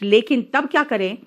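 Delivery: ordinary talk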